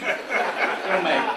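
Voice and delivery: slow mock-sermon promise with a chuckle; preacher emphasis. Shown as a demonstration. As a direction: preach it like a Baptist blessing. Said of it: "Good Baptist".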